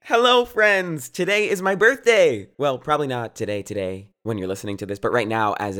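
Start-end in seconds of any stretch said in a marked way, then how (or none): 0.0-2.5 excited. Real, not acted. Birthday greeting, excited.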